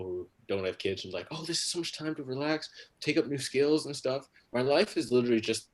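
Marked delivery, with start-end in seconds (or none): 1.3-4.3 higher, airy delight; gushing imitation of people with free time; mock enthusiasm. Childless people marvelling at quarantine free time, mock-delighted.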